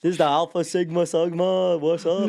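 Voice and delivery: loud, high, shouted hello; hype greeting. Real, not acted. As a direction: greet the crew like a hype man.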